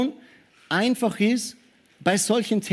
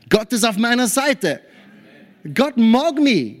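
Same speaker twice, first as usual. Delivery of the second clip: triumphant believer; 5 semitones higher, 4 dB louder than usual. Pretend. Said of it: "dass wir sagen".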